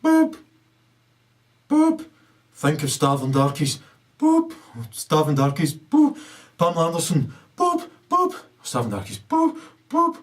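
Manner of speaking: squeaky high boops between the words; imitated beeps. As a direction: chirp each boop like a machine. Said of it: "I'd be sitting there going".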